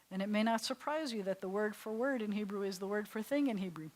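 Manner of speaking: ordinary delivery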